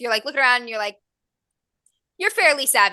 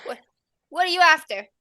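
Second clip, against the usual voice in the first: high, louder demand in a put-on Irish accent; suspicious little Irish girl, pitched up; 8 semitones higher, 4 dB louder than usual. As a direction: challenge them in an amateur Irish brogue. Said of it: "heavy Irish accent".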